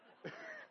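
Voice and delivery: a sudden high laugh that breaks out; high burst of laughter. Real, not acted. To the audience about the slang, a high laugh. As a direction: laugh high at the slang you just quoted. Laughs at his own quote.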